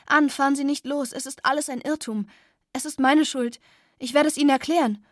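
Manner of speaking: high, loud pleading, rushing at the end; desperate calling. Acted. Girl begging Anne at the car door, loud and fast. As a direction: beg her not to leave. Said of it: "rief ich".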